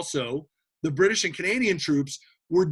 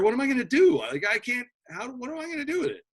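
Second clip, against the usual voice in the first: exasperated captain; 6 semitones higher, faster than usual. Acted. Battleship captain facing rowboats.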